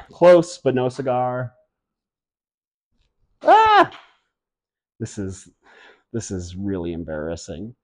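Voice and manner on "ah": high sharp squeal at a miss; squeal of dismay. Real, not acted. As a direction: yelp as the throw fails. Missed throw.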